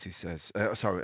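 ordinary talk, nothing marked

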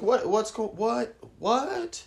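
high, stammering panicked wife; mock freaking out. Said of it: "the wife is freaking out".

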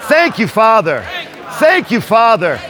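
rising shouted thanks. Real, not acted. Congregation shouting thanks repeatedly.

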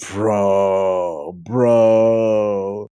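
low, weary disbelief; a hint of a groan at the game; mock exasperation; lightly exasperated bro.

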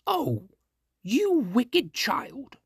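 high, slow witch cry; angry witch. Acted. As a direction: shriek it out like a wicked witch. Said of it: "cried the witch".

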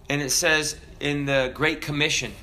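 ordinary talk

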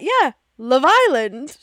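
"Love Island" slow, drawn out and loud; odd Love Island. Retold or imitated. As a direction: stretch "Love Island" strangely, slowly and loudly. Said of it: "Why are you saying it like that".

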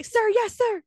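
high loud barked reply; military shout. Pretend. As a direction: shout it like a cadet. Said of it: "how do they say".

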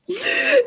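high-pitched laughing burst; burst of laughter. Real, not acted. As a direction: crack up at the absurd tooth. Laughing at the shark tooth.

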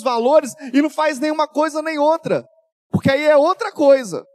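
loud, high preaching at full force; fiery preaching. Acted. Pastor warning the church about family, preaching at full force. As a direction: preach it hard to the whole church.

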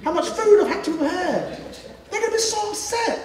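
high, pleading, laughing complaint; high-pitched whiny parent begging to be let in, with laughter. Retold or imitated. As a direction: plead like an exhausted parent turned away at the door.

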